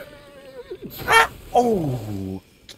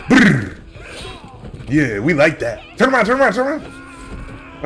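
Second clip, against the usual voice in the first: hyped shout; 9 semitones higher, 7 dB louder than usual. Real, not acted. Hyped during fight.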